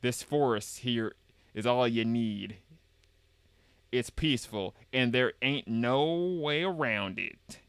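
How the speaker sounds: put-on slow Southern old-man drawl; Southern accent. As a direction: lecture a child in drawn-out Southern. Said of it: "somebody uses Southern voice".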